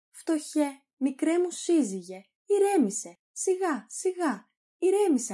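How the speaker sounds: high-pitched, fussing wife; calm him down in a high, coaxing voice; soothing wife. Acted. Wife soothes her angry husband.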